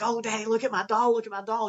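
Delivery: put-on high, bright child begging to be seen; excited child. Child showing daddy a doll, high and eager. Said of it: "they're like".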